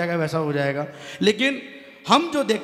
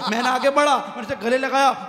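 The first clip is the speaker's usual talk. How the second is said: usual speaking voice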